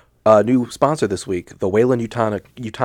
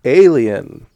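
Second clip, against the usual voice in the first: horror trailer voice; 3 semitones higher, 7 dB louder than usual. Acted. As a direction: boom out the movie title. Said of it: "say the name of the movie".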